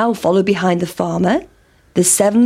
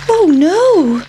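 high, louder storybook alarm; alarmed little gasp of words, acted; dismayed pancake; 9 semitones higher, 5 dB louder than usual. Pancake fears being eaten.

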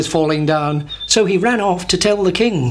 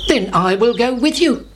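raised storybook duck voice; high, lilting offer to come along; Ducky Lucky; 7 semitones higher than usual. A narrator's reading. Duck offers to join the chick, high put-on voice. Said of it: "said Ducky Lucky".

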